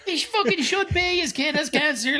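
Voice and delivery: high, drawn-out exasperated retort; mock outrage. Reacting to the film dad coming home, mock outraged. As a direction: protest in a slow, high-pitched voice.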